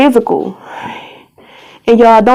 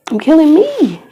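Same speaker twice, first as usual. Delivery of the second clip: high voice welling up with grief; real anguish; 7 semitones higher than usual. Real, not acted. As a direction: let grief push the voice up, word by word. Breaking down over the abortion.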